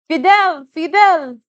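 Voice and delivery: high, loud siren-sharp cry; screaming name. A narrator's reading. Parade crowd sees their leader and screams, high and loud. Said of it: "the screams get as sharp as sirens".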